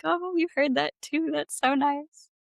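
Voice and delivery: high, bright-eyed mock surprise; delighted surprise. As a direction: play up the happy surprise. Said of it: "which I'm like".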